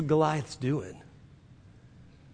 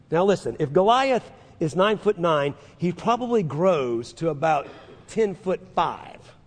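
usual speaking voice

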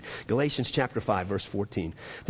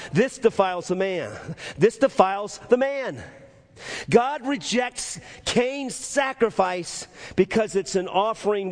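raised, emphatic preaching pitch; 7 semitones higher than usual. Real, not acted. Preacher telling the church what comes out of the heart, preaching. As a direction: drive the point home to the congregation.

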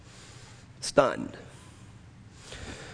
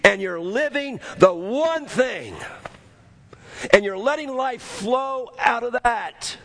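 preaching at full force; 8 semitones higher than usual. Acted. Pastor drives the point home to the congregation, preaching at full force.

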